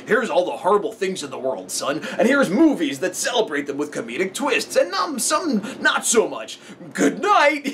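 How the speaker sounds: a lightly voiced cheerful dad; imagined dad. Imagined dad sending his son to bed, in a lightly put-on dad voice. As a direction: put on a matter-of-fact dad signing off at bedtime. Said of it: "This kid's dad must just be like".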